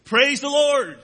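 loud, high cry of praise; happy morning shout of praise; joyful shout. Acted. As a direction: shout it off the porch to the whole street. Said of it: "she would shout every morning".

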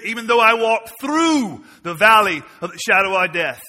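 loud, high fiery sermon cry; full-force preaching. Acted. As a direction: preach it out to the back row.

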